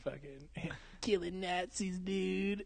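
slow, high, soft stoner voice; stoner impression. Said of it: "It's a fucking stoner shit".

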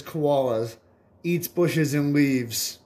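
drawn-out slow delivery; slow punchline. Acted. Comedian reads out the dictionary punchline, slow and deliberate. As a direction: land the punchline slowly.

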